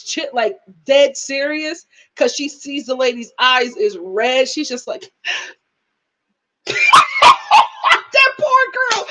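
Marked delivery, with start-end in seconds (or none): 5.3-9.1 slow, drawn out through laughter; laughing so hard the words stretch; real laughter while pitying her. Real, not acted. Laughing at the awkward scene.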